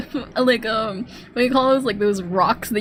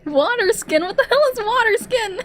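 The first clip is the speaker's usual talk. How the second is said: baffled disbelief; 11 semitones higher than usual. Real, not acted. Baffled by odd game word.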